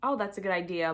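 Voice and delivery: ordinary delivery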